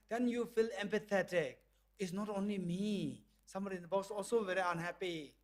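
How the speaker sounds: ordinary delivery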